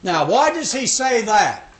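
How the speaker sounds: usual speaking voice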